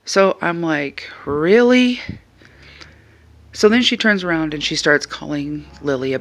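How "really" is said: high, loud disbelieving really; a sharp incredulous pitch leap on a single word. Retold or imitated. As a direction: let the disbelief jump up high. Incredulous retort to rude woman.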